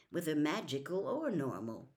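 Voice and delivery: ordinary delivery, nothing marked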